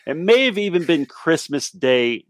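usual speaking voice

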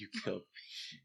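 very soft ghostly reproach; echoed mock Banquo. Co-host echoing Banquo's ghost, hushed. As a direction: echo the wounded spirit, barely voiced. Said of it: "Quoth the Banquo".

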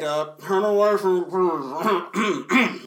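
slow, garbled mumble dissolving into coughs; evasive mumble. Retold or imitated. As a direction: pretend to answer while swallowing every word. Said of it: "I didn't quite get that".

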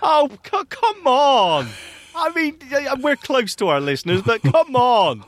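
high, indignant protest; mock outrage. Real, not acted. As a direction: act scandalised at the teasing. Mock outrage at being called phlegmy.

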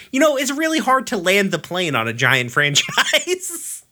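laughing through a mock review; amused book reviewer tone. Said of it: "I'd be like".

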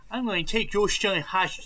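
put-on high, babyish threat. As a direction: say it like a toddler. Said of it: "but, like, in a baby voice".

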